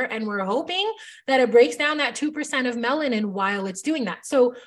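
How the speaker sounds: usual speaking voice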